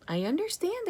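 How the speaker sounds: high, small squeak of a voice. A narrator's reading. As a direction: pipe it up like a tiny hamster. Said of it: "I squeaked".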